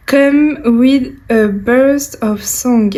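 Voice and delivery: slow, careful English with a French accent; English read slowly by a French speaker with a real French accent. Real, not acted. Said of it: "Vous voyez mon accent magnifique".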